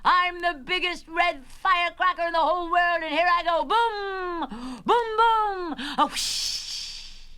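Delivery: high, loud triumphant yell into explosions. Acted. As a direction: yell the boast, then burst like a firework.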